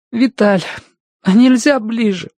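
put-on high, tearful boy's plea; through tears. A narrator's reading. Boy begs for a nearer grave, through tears. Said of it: "сквозь слёзы выговорил Тенька".

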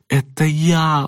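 put-on high-pitched, urgent young boy; excited boyish call. Friend calls out to frightened boy.

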